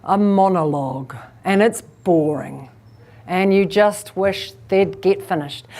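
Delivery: slow, low, boring drone; tedious low-pitched monologue. Shown as a demonstration. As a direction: plod through it with no variation. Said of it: "If it's a slow pace".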